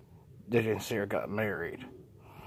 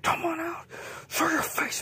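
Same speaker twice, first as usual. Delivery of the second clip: defiant challenge. Acted. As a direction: yell a dare at the monster. J J challenging hidden beast.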